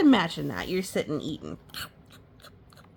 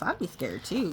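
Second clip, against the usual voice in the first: usual speaking voice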